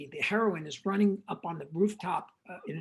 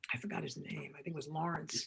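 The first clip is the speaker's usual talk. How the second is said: unvoiced whisper to the side; whispered aside; 2 semitones lower, 9 dB quieter than usual. Real, not acted. Storyteller whispers an aside while forgetting a name. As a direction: drop to a whisper as you forget.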